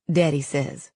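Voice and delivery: ordinary delivery, nothing marked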